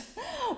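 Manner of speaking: short high-pitched laugh. Real, not acted. Vlogger fed up with the questions, brief high laugh. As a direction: let out a quick high laugh. Exasperated laugh at own video idea.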